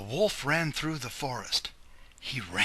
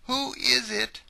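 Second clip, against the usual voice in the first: put-on old grandmother; 13 semitones higher, 6 dB louder than usual. Grandmother answers the door.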